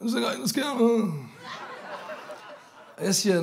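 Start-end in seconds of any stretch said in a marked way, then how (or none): 0.1-1.1 higher, sloppy drunk mimicry; drunk Liam. Retelling Liam's complaint in his drunk voice. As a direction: lightly put on the drunk friend again. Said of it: "Sådan lyder han, når han er fuld".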